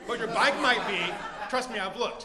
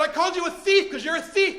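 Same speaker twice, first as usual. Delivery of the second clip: loud, high acted retort; angry accusation; 7 semitones higher, 8 dB louder than usual. Owner answering the offended thief, angry and loud. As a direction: fling the accusation right back.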